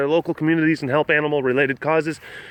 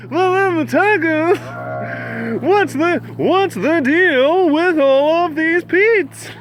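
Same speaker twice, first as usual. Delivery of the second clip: high, loud, stretched-out comedian impression; hammed-up stand-up comic setting up a bit; Seinfeld; 13 semitones higher, 5 dB louder, much slower than usual. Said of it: "Do Seinfeld".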